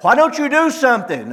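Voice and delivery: high, pleading shout on why don't you do something; shouting at God. Retold or imitated. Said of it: "you begin to shout at God".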